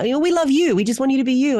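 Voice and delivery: high-pitched imitation of a placating director; mock-placating, pitched well up; reassuring director. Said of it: "Catherine was like".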